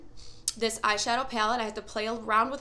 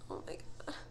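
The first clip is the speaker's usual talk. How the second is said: awed whisper; 12 dB quieter, much faster than usual. Real, not acted. First look at the highlighter.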